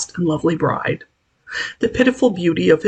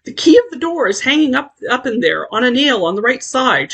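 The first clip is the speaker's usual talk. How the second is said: mild raised call through a locked door; shouting; 7 semitones higher than usual. Acted. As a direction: shout the directions to her. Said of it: "shouted Teddy".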